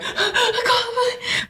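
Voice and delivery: high, slow, sobbing plea; crying wail. Retold or imitated. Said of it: "this was more like".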